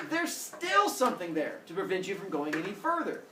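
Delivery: usual speaking voice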